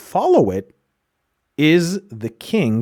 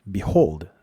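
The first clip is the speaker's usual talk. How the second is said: normal talking voice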